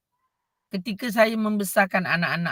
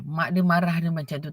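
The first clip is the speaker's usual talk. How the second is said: ordinary talk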